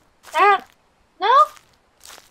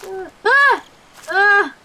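short, high yelps of fright; real startled cries; scared yelps; 7 semitones higher, 4 dB louder than usual. Real, not acted. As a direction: yelp in alarm, twice. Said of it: "I'm scared".

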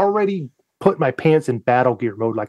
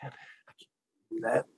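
hushed aside; 7 semitones lower, 16 dB quieter, faster than usual. Retold or imitated. Retold hushed thought on watch.

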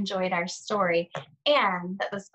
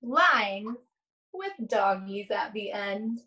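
put-on slow, sing-song read-aloud to young children; lingering on each rhyme, long pauses between pages; story read aloud; 3 semitones higher, much slower than usual. Reads picture book aloud slowly.

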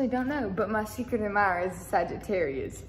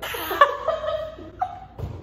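high laugh; 7 dB louder than usual. Real, not acted. Burst of laughter.

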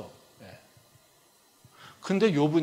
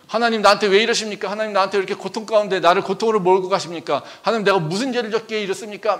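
loud, pleading outcry, higher and faster; anguished complaint to God; 5 semitones higher, 7 dB louder, faster than usual. Retold or imitated. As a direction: cry out to God like a sufferer who feels wronged. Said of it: "막 이러면서". (하나님께 울부짖듯 크게 원망하며)

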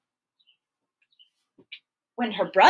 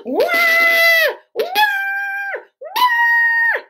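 high, loud fake bawling; the storyteller hams up Andrea's crying for the kids; mock toddler wail; 8 dB louder, much slower than usual. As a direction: howl like a frustrated little girl. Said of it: "she would cry".

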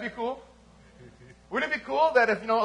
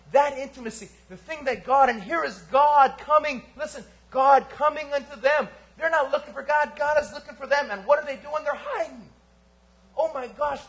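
loud, higher, driving sermon voice; full-force preaching; 5 semitones higher, 5 dB louder than usual. Acted. Pastor exhorts the congregation about their greatest need, preaching hard. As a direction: preach it hard to the back row.

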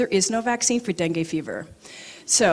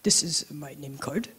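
soft hushed monk; 5 semitones lower, 6 dB quieter than usual. Retold or imitated. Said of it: "He, he goes".

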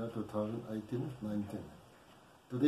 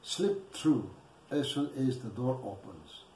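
louder, measured poetry reading with pauses; poem recitation; 2 semitones higher, 7 dB louder than usual. Acted. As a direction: declaim the poem phrase by phrase, projecting firmly. Recites own poem after introduction.

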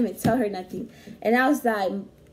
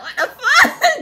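much higher, louder; shocked outburst; 11 dB louder, slower than usual. Retold or imitated. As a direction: blurt it in shock. Retold shocked reaction.

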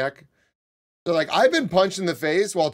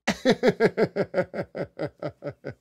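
long staccato chuckle tapering away; real laugh; 2 semitones higher than usual. Real, not acted. Streamer amused by his own riff, long laugh. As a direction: chuckle on and let it fade.

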